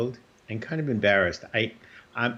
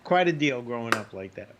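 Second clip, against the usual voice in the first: ordinary talk, nothing marked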